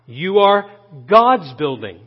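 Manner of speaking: "God's" set off and punched; emphatic "God's", falling on building; stress on GOD'S. Real, not acted. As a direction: hit "God's" after a slight hold. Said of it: "about what God is building".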